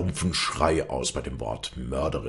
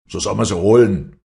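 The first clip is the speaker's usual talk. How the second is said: very loud, raised order; commanding; 5 semitones higher, 11 dB louder than usual. A narrator's reading. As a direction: bark the order to fetch her. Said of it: "befahl Stromminger".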